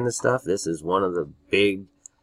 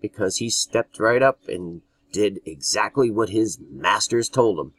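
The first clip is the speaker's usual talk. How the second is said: ordinary talk, nothing marked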